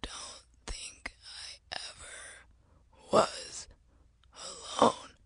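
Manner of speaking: slow whisper with long broken pauses; dying whisper. Acted. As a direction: whisper each word on failing breath. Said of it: "he whispered".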